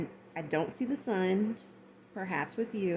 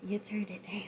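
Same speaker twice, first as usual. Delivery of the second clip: whispered; 5 dB quieter than usual. Acted. Repeats line in whisper.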